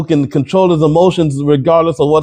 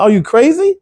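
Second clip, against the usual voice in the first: incredulous outburst; 10 semitones higher than usual. Real, not acted. Outraged rhetorical question.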